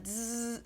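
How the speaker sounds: imitated electric buzz; drawn-out "zzz" like an antenna.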